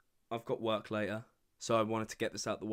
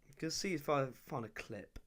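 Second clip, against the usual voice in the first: ordinary talk, nothing marked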